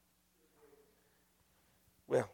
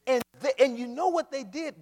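ordinary delivery

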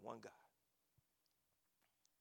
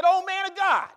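calling out; 14 semitones higher, 22 dB louder than usual. Retold or imitated. Soldiers to Elijah on the hilltop, calling out. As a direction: holler it up at the prophet.

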